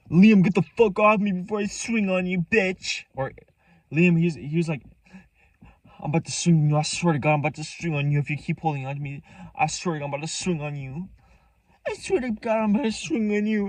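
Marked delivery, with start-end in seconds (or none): 0.1-3.0 high, loud mock threat; imitated angry shout. Acting out friend's angry threat. 6.0-13.7 high mock-angry warning, climbing higher; imitated threat. Acting out friend's repeated threats.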